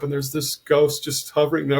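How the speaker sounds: ordinary delivery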